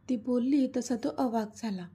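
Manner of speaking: ordinary delivery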